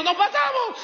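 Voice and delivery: high, laughing mock panic from the children; panicked kids. Children in the skidding car yelling in mock panic. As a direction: cry it out like a scared kid.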